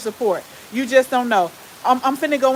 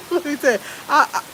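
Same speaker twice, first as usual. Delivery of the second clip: laughing through words; 4 semitones higher, much faster than usual. Real, not acted. Laughing fit mid-story.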